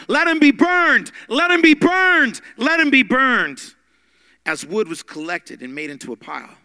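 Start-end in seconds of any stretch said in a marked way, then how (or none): 0.1-3.7 very loud, high, slow chant; crowd yelling. Retold or imitated. Crowd demanding Polycarp's burning, yelling. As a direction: roar it like a mob. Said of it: "The crowds begin to yell".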